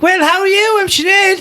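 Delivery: very high, put-on greeting; brash twin sister voice. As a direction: squawk a hello way up high, hammed-up. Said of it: "with her sort of twin sister".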